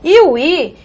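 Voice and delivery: normal talking voice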